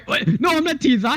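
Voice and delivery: high, hammed-up fake denial; a dinosaur wrestler denying who he is, in a high mock voice. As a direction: squeak it out like a bad liar. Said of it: "He goes".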